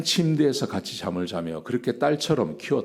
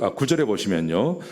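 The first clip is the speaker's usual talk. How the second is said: ordinary talk, nothing marked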